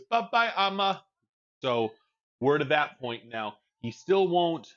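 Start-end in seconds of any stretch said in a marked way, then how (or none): 0.0-1.0 imitating toddler. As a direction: copy the toddler's proud higher "Bye-bye, Amma". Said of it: "He goes".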